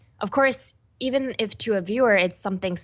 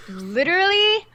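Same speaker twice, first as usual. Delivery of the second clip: loud, laughing mortification; real embarrassed laugh; mortified laughing; 6 semitones higher, 4 dB louder, slower than usual. Real, not acted. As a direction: cry out half-laughing in embarrassment. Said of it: "I'm dead".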